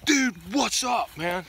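slow mock buddy greeting; laid-back dude. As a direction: voice the boar as a chill dude. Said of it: "like, you know".

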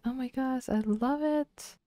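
high, soft delighted squeal; delighted gushing. Acted. Voicing a delighted Sim.

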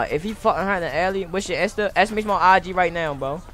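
usual speaking voice

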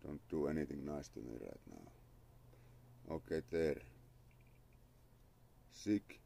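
normal talking voice

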